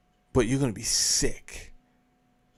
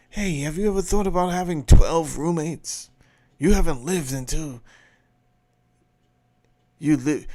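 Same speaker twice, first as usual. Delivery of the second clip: higher, louder mock ad spiel; mock commercial pitch; 4 semitones higher, 7 dB louder than usual. Host parodying ads for crowded city living, mock pitch. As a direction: sell it like an over-eager commercial. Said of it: "the commercials are out there right now basically saying that".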